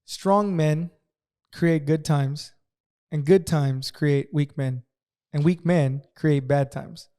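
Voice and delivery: slow, measured, each clause weighed; reciting an old saying to the friend, slow and deliberate; slow proverb recital. Shown as a demonstration. Reciting a well-known saying to make a point, slowly and evenly. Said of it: "what's that saying".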